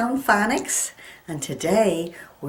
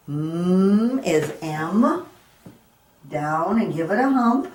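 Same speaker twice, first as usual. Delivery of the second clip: normal talking voice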